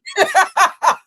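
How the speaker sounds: high, loud burst of laughter. Real, not acted. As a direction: laugh hard in shock. Reacting to clip.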